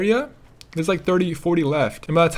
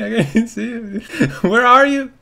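raised voice breaking into laughs; laughing; 5 semitones higher, 4 dB louder than usual. Real, not acted. Player searching for drowning teammate, laughing hard. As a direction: call out through real laughter.

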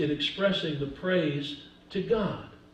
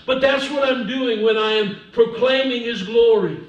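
usual speaking voice

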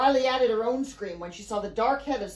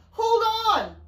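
high, loud, urgent shout; shouting from above; 12 semitones higher, 6 dB louder than usual. A narrator's reading. Mary warns everyone from above, urgent shout. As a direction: shout it as a desperate warning. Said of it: "Mary shouted from above".

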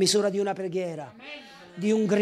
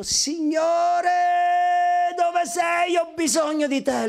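higher, ringing, urgent plea; crying out to God; 6 semitones higher, slower than usual. Retold or imitated. Preacher recalling calling God wholeheartedly, urgent cry. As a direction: cry out with your whole heart.

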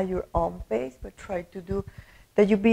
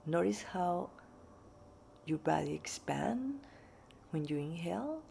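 guided meditation; 3 semitones lower, 17 dB quieter than usual. Acted. Teacher welcoming listeners to a breathing meditation, soft guided meditation voice. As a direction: ease them gently into calm, quiet and unhurried.

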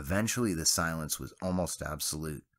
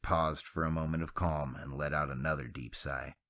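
plain reading voice

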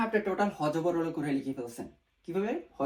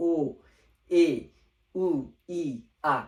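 vowels said backwards, slowly with pauses; slow reversed recital; 6 dB louder, slower than usual. Shown as a demonstration. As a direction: recite it in reverse, pausing to think. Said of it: "আমি কিন্তু অত দ্রুত বলতে পারি না". (উল্টো ক্রমে ধীরে ধীরে থেমে থেমে বলা)

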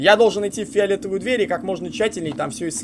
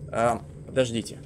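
ordinary talk

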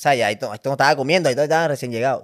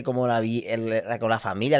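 usual speaking voice

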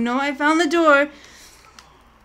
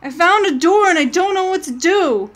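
panicked; 5 semitones higher, 6 dB louder than usual. Real, not acted. Streamer panics finding a door.

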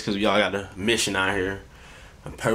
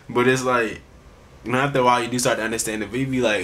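normal talking voice

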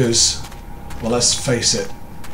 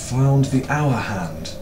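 ordinary talk

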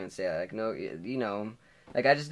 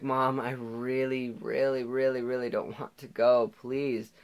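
retold drawn-out, whiny plea; pleading son; 3 semitones higher, slower than usual. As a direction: beg mom, slowly leaning on each word. Begging mom not to go.

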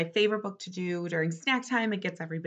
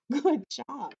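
usual speaking voice

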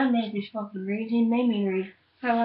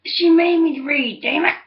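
loud complaint; 4 semitones higher, 7 dB louder than usual. Real, not acted. Friend mocking loudly.

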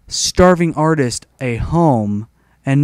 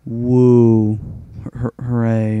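sarcastic cheer; 3 semitones lower, much slower than usual. Retold or imitated. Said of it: "And I'm like".

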